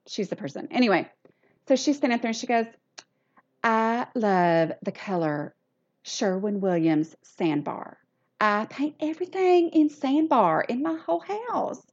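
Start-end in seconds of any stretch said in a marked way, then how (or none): 3.6-11.8 lightly imitated blogger voice; Carrie Ann accent. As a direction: drawl it like Carrie Ann on stage. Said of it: "I'm gonna do a Carrie Ann accent".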